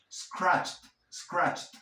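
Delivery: scratched as one syllable, "scratcht"; final D as T. Shown as a demonstration. Teacher drilling the past tense, scratched ending in t. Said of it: "pronunciar la D como una T".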